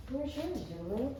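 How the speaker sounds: ordinary delivery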